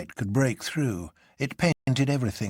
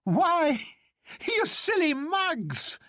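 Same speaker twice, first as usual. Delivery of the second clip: high, slow, gleeful jeer; high jolly doctor, teasing his friends; Bull crying out; much slower than usual. A narrator's reading. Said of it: "he cried".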